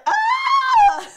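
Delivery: high shrill-free shriek imitating him; mock scream. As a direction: let out a hammed-up girlish shriek. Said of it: "He was screaming like a little girl".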